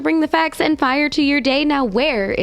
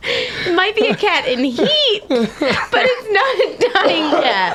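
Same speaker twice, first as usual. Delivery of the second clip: laughing; 8 semitones higher, slower than usual. Real, not acted. Laughing while replying.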